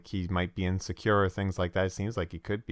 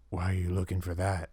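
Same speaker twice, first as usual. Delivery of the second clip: lightly voiced wary stranger; a hint of a suspicious bounty hunter; cautious NPC; 3 semitones lower, 3 dB quieter than usual. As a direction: question them guardedly, a touch understated. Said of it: "he's, like, cautious".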